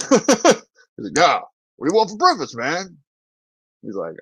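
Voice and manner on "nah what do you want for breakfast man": higher, insistent repeat question; high-pitched, easygoing dad impression; big Australian dad. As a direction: imitate the Aussie dad brushing off confusion. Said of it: "big Australian dude walks in and goes".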